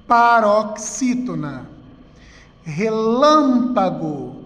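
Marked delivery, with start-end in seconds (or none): 2.7-4.4 "Relâmpago" as one word with a long, strong lâm; stressed antepenultimate syllable. Shown as a demonstration. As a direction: stretch lâm in "Relâmpago" without breaking the word. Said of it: "Antepenúltima sílaba mais forte". ("Relâmpago" inteiro, alongando o lâm)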